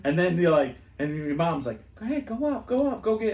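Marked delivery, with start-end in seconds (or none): 1.9-3.3 high-pitched prodding mother; urging mom. Retold or imitated. Mom sends her kid up for a prize, high urging voice. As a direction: push the kid along in a high, eager voice.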